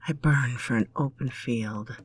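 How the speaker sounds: usual speaking voice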